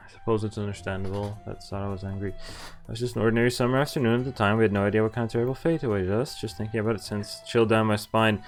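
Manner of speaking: ordinary talk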